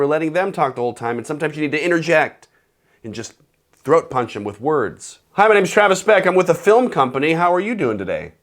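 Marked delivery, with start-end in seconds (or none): 5.3-8.3 higher and louder, upbeat and lively; enthusiastic intro. Shown as a demonstration. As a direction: pitch yourself brightly. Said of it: "Just a little bit of variety".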